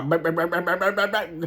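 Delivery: loud imitated engine bangs; imitating the clunking engine of a beat-up car; car banging imitation. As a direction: bang it out like a broken-down car. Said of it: "My car was sounding like".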